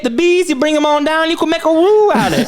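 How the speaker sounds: put-on Cajun accent. Cooking host inviting folks down, hammy Cajun. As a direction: sell it like a Louisiana chef. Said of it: "Cajun accent".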